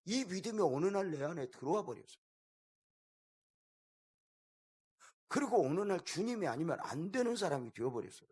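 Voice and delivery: fervent preaching. Real, not acted. Preaching climax on faith.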